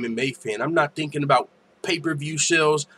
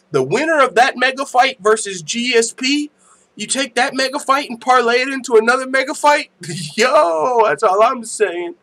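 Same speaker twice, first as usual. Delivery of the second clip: loud, high-pitched fight-fan hype; thrilled, raised voice bursting with hype; excited hype; 8 semitones higher, 5 dB louder than usual. Real, not acted. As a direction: get carried away, pitched right up. Hyped about a dream mega fight.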